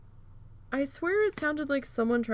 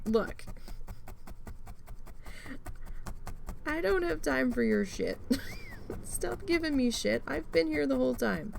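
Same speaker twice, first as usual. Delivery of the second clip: high, emphatic telling-off of a dog; exasperated scolding; 6 semitones higher than usual. Retold or imitated. Owner telling off her back-barking dog, high and fed up. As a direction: lay down the law to the dog.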